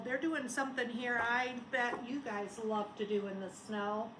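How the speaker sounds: normal talking voice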